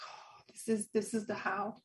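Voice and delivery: whispered realization of awe; awed whisper. Retold or imitated. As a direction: breathe it out as a quiet revelation.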